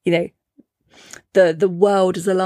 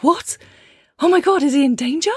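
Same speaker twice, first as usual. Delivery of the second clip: higher, quick retold alarm; panicked mum; 7 semitones higher than usual. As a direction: act out a mum's sudden fright for her child. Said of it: "real mum moment of going".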